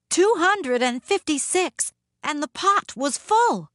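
triumphant tallying; building excitement. A narrator's reading. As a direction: climb excitedly to the big finish. Counting builds to full pot.

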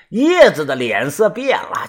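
plain reading voice

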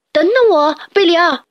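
urgent wait-for-me; calling after. Acted. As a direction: shout after him running. Running after friend.

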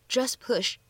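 "just push" run together, the t in "just" dropped; lost plosion. Shown as a demonstration. Teacher demonstrates linking to learners, "just push" with no released t. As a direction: drop the t of "just" straight into "push". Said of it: "失去爆破的处理".

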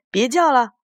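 exasperated storybook yell; acted shout. A narrator's reading. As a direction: shout them down. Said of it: "波库斯喊道".

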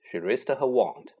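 plain reading voice

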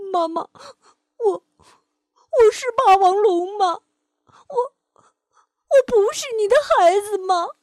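high, loud, slow sobbing questions; sniffling little dinosaur asking his mother; tearful child. Acted. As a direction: stammer through tears to mum. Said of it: "梁太流着眼泪， 吸着鼻子说".

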